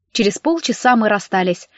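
usual speaking voice

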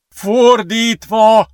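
high, drawn-out yell; shout. A narrator's reading. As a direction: yell the sudden realisation. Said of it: "ordította".

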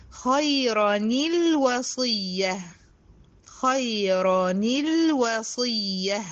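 خيرًا الوصية slow with the nun joined: khayran-il-wasiyya; tajweed demo. Shown as a demonstration. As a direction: join the tanween into الوصية. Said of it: "خلي بالك". (وصل التنوين بكسر النون)

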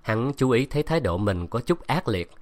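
ordinary delivery

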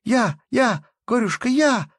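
very high, loud squealing; shrill squeal. Acted. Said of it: "звончее всех визжала".

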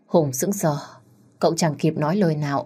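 ordinary delivery, nothing marked

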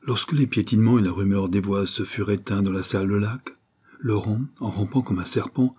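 plain reading voice

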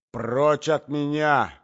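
slow, high, desperate yell; desperate cry. A narrator's reading. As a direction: cry out at the end of your tether. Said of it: "закричала Марья Александровна, доведённая до отчаяния".

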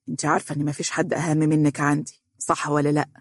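ordinary delivery, nothing marked

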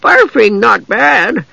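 high, slow creature voice, arguing; perked-up simple-minded dwarf; brightening gully dwarf. A narrator's reading. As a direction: argue cheerfully in a drawn-out high voice. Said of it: "the gully dwarf argued, brightening".

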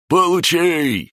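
high, drawn-out triumphant yell; gleeful high-pitched shout; excited cry. A narrator's reading. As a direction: cry it out high, stretching the word. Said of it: "закричал зелёный".

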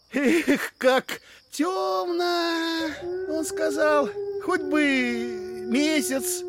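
high, long-drawn wail; theatrical lament. Acted. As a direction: wail it out, stretching the vowels. Brother laments the dark, acted. (высоко, протяжно причитая)

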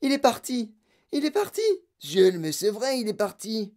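high-pitched cry of alarm; high, distressed exclaiming; wailing yell. Acted. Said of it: "elle hurla".

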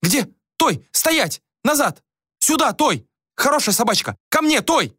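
high-pitched female voice, short commands; flustered queen. A narrator's reading. Little queen calls off her mastiff, flustered high commands. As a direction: order the dog about in a high voice.

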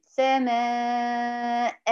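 سماء drawn out long, ending in -an; tanwin fatha shown. Shown as a demonstration. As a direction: hold the long vowel of سماء, then sound the n. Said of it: "بقف عليه بألف مد طبيعي".

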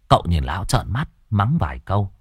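plain reading voice

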